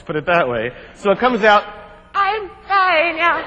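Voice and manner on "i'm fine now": squeezed out much higher and slow; strained squeak. Retold or imitated. Hanging by his nether parts, answering his partner in a slow strained squeak. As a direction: squeak it like the rope's crushing you. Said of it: "I'm hanging from the rope by my nether parts".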